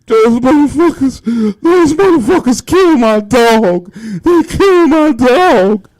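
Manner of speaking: loud, high-pitched wailing; acted-out anguished bawling, words stretched by sobs; ugly cry. Man kneeling over his killed dog, ugly crying. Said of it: "talking about that ugly cry".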